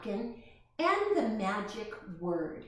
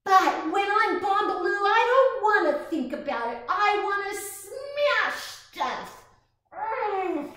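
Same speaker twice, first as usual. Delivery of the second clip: angry; 9 semitones higher, 7 dB louder than usual. Acted. Angry child's inner monster.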